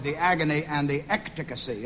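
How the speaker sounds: Ecticacy, with an extra k syllable for Ecstasy; mangled title word. Acted. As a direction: confidently mispronounce Ecstasy as Ecticacy. Comic mispronunciation of book title.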